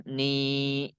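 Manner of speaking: NI with a long held vowel; mad asli. Shown as a demonstration. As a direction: stretch "ni" out evenly. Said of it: "Dikasih ya sukun jadinya". ("ni" dibaca panjang)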